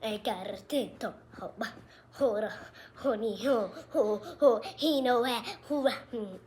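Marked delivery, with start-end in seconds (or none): none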